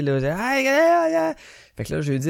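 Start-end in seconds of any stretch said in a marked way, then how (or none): 0.2-1.3 imitating the chaos of background shouts; mock yelling. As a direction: ham up the crowd screaming behind her. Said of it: "Là, ça criait".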